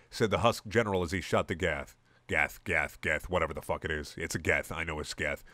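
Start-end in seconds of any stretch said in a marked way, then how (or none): none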